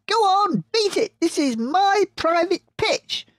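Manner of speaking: very high, loud rat shriek. Acted. Rat voiced.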